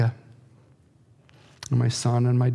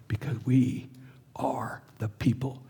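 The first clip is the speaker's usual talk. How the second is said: whisper; 6 dB quieter than usual. Real, not acted. Grief-laden sermon.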